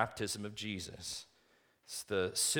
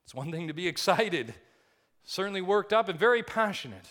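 fired-up preaching; 8 semitones higher, 9 dB louder than usual. Acted. Preacher builds on the word passion.

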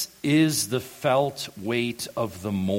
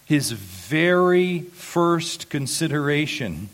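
higher, louder climactic preaching; impassioned preaching; 7 semitones higher, 4 dB louder than usual. Acted. Pastor building to groaning as David's first thought, fervent preaching. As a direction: drive home the point with fervour.

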